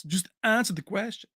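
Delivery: put-on Belgian action-star accent, lightly done; mock Jean-Claude Van Damme accent. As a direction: growl it like a tough movie hero demanding answers. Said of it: "I do good accents".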